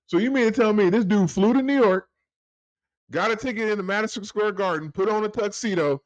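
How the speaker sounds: high pitched disbelief; can't believe what he's hearing; incredulous. Real, not acted. Incredulous rant about campaign denial.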